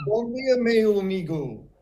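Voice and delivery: retold foreigner's attempt at "Bom dia, meu amigo"; repeated Portuguese greeting. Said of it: "And I say".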